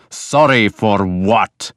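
slow, stern challenge; demanding. A narrator's reading. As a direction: demand an answer, drawing out each word. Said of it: "Ragnar demanded".

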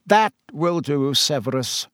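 plain reading voice

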